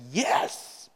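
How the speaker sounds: short, insistent yes rising high; emphatic. Acted. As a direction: press the answer stubbornly. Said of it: "Jonah's more emphatic".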